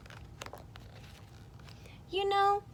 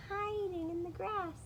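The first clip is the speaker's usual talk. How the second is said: very high, thin-timbred little voice; rabbit-side character voice; 11 semitones higher, 3 dB quieter than usual. Acted. Reader offering the rabbit option, in a very high voice. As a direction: pitch it way up, keep it small.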